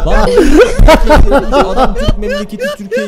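high, loud excited cry. Real, not acted. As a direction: blurt it out high. Güldükten sonra bağırarak. (heyecanla bağırma)

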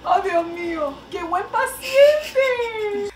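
high, slow, loud gush with laughter; gushing squeal. Real, not acted. Praising Firulais as a patient, high gushing squeal. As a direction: squeal over a good little patient.

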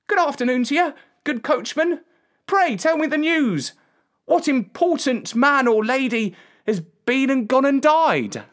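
put-on very high, polite boy's voice; inquisitive shepherd boy. A narrator's reading. Boy asks coachman who has died, high curious voice. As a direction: call up brightly as a young lad.

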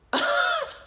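sudden high-pitched real laugh; burst of laughter. Real, not acted. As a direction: crack up at your own imitation. Laughs at her own imitation.

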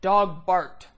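loud, blunt caveman grunt of two words; teacher mimicking a caveman's stripped-down sentence. Shown as a demonstration. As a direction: bark it like a caveman. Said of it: "a caveman would say".

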